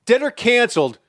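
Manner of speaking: host the quiz like a TV emcee; game show host. Acted. Host opening the Dead or Canceled game for Will Leach, game show announcer.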